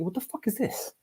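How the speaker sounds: hushed, stunned disbelief. Retold or imitated. Discovering the double books.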